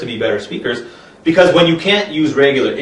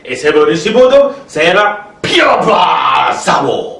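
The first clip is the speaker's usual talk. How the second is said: higher, slow, shouted nonsense words; emphatic gibberish expert; 4 semitones higher, 4 dB louder, slower than usual. Acted. Gibberish expert declaring soup wisdom, slow shouted gibberish. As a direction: drag it out and proclaim it. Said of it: "an example of gibberish expert translation".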